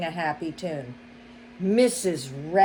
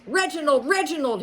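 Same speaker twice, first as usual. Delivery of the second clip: roaring for the butler; 8 semitones higher, faster than usual. A narrator's reading. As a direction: bellow for the butler. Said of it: "he roared".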